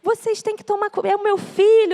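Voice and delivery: high, urgent mock yell of a distraught father; retold shouting. Retelling husband confronting the doctor at the ICU, mock shouting. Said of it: "o Júnior começou a gritar".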